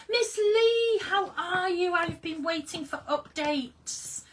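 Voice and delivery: high, loud delighted hello; overjoyed loud welcome; excited greeting. Real, not acted. Greets a viewer joyfully.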